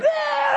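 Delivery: high-pitched newborn squall; imitated baby wail. Dad mimicking his newborn at home, high wail. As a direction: let out a high mock baby cry. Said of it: "And he's like".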